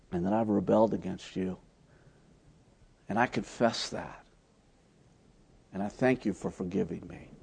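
soft, hushed prayer of confession; confessing to the Lord, soft and hushed; 1 quiet confession prayer. Retold or imitated. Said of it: "lead me to the place of saying".

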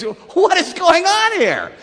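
high, loud, drawn-out bewilderment; mock astonishment. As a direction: cry it out slowly in acted disbelief. Voices the stunned crowd's reaction.

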